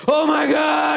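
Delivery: high, loud thrilled yell; high excited exclamation; excited shout. Retold or imitated. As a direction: shriek it like he can't believe his luck. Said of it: "and be like".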